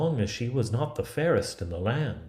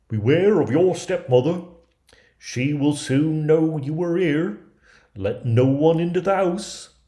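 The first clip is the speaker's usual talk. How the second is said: higher, louder dwarf voice of warning; urgent little dwarfs, raised and loud; 7 semitones higher, 8 dB louder than usual. Acted. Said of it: "the good little dwarfs warned her".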